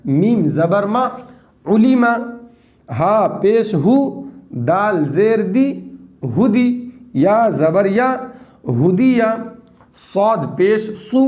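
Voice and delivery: slow syllables with a lifted joined word; slow spelling drill. Shown as a demonstration. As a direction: model the reading clearly. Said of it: "teacher drills syllables".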